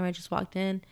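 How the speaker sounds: ordinary talk, nothing marked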